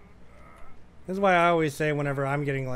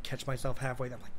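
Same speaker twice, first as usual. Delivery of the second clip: ordinary delivery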